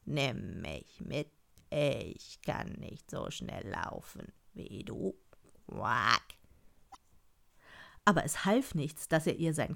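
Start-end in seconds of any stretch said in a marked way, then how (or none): 0.1-6.2 slow, low, soft frog plea; low laboured frog voice; left-behind frog. A narrator's reading. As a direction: plead slowly, end on a croak. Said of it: "rief der Frosch".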